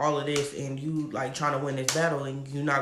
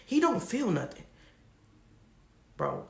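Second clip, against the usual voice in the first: ordinary talk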